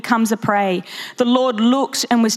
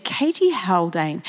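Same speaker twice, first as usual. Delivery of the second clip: slow, softer, a hint of a stern grandmother; 5 semitones lower, 4 dB quieter, slower than usual. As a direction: scold the full name lightly. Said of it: "she said".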